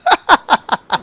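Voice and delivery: high-pitched burst of laughter; real laugh. Real, not acted. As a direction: laugh at your own awkward answer. Laughs after whoops answer.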